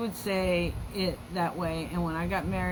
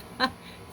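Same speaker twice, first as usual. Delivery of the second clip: quick breathy chuckle; a brief unvoiced laugh; short laugh; 6 semitones higher than usual. Real, not acted. Host laughs at the mom's kind excuse, short chuckle.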